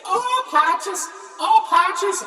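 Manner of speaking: sing-song the name high like the Russians; Russian cosmonauts' way. Retold or imitated. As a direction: Irishman imitating the cosmonauts calling his name, high and loud. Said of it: "in their Russian ways".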